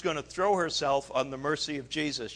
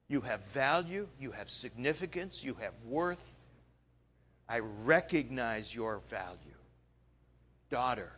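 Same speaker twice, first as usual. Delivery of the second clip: tender reassurance; 3 semitones lower, 3 dB quieter than usual. Acted. Jesus comforting healed woman.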